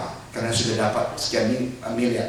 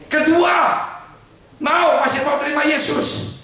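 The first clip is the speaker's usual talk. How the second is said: higher, louder menacing village chief; bully him to deny his faith; threatening chief; 13 semitones higher, 5 dB louder than usual. Acted. Village chief threatens the evangelist's family, higher and menacing.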